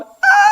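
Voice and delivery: sudden high yelp he didn't do; a shrill cry he held back; mock startled yelp. Pastor imagining a startled yelp. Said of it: "thank God I wasn't going".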